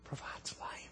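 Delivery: breathy whispered aside. Acted. Preacher whispers the point to congregation, hushed. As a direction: whisper it like a secret. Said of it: "whispered line".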